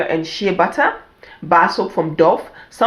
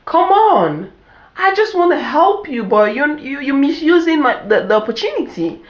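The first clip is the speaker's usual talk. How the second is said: exasperated parent; 8 semitones higher than usual. Retold or imitated. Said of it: "I'm like".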